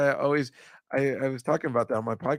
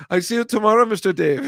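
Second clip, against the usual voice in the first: put-on a light Spanish-ish accent, higher and louder; mock accent; 7 semitones higher, 7 dB louder than usual. Quoting his cleaning lady's text, a light mock Spanish accent. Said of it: "I don't know what accent that is".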